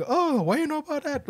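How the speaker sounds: high, laughing mock disbelief; teasing imitation of a sceptical older person; mock doubting question. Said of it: "people would always be like".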